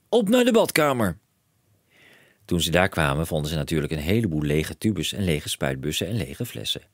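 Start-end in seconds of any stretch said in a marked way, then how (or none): 0.1-1.2 high and loud, racing with plans; a dad who sees a fortune; excited cry. A narrator's reading. Father telling his son of riches, high excited call.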